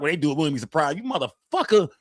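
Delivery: usual speaking voice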